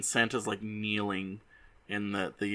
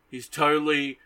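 ordinary delivery

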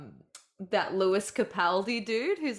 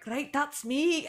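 slow, higher-pitched mock Scottish; bad Scottish accent; 8 semitones higher, slower than usual. Imitating a Scottish singer's comeback, bad Scottish accent. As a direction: have a clumsy go at a Scottish accent. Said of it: "I can't do a Scottish accent".

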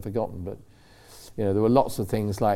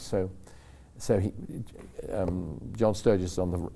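ordinary delivery

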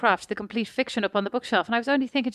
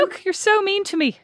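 high, slow, hurt whine; mock hurt whine; 10 semitones higher than usual. Real, not acted. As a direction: play wounded at the teasing. Protesting sister's teasing.

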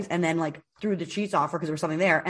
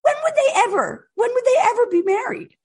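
much higher, louder exasperated cry; outraged disbelief; 4 dB louder than usual. Real, not acted. Friend ranting about the casting, high exasperated disbelief. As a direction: when would they ever, come on.

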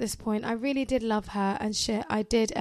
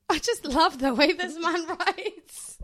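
laughing while reading; 7 semitones higher, slower than usual. Real, not acted. Amused by the writing.

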